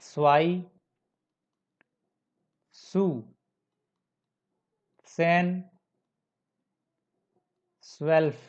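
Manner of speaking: स्वाई, सु, सेन, स्वेल्फ each begun with a crisp "ts"; German Z as TS. Shown as a demonstration. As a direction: model स्वाई, सु, सेन, स्वेल्फ one by one with initial "ts", long pauses between. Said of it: "कुछ example देखते हैं". (स्वाई, सु, सेन, स्वेल्फ की शुरुआत "त्स" ध्वनि से)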